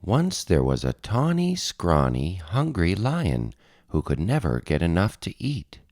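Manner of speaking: plain reading voice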